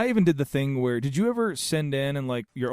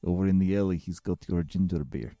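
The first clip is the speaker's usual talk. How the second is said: low, put-on vaguely Russian accent; a shady alley seller in a vague Russian accent; 5 semitones lower than usual. Offering ginger beer from the alley, in a loose Russian accent. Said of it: "I don't know what accent that was".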